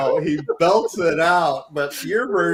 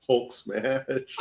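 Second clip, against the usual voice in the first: laughing Hulk; 4 semitones lower, 6 dB quieter than usual. Pretend.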